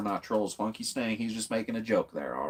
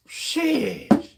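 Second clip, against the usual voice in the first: high, stretched-out sheesh; excited exclaim; 6 dB louder than usual. Real, not acted. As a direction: let it out, amazed and loud. Reacting to a crazy live moment.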